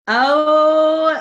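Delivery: usual speaking voice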